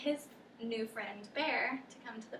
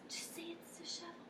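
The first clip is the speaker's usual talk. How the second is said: fast, much softer whisper; rushed whisper; 6 semitones higher, 13 dB quieter, faster than usual. Acted. Dog pushing the cat to agree, fast whisper. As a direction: hurry the demand out in a whisper.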